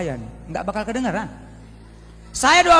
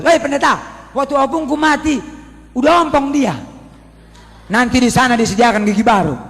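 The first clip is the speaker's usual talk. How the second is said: Batak man protesting; 6 semitones higher, 10 dB louder than usual. Retold or imitated.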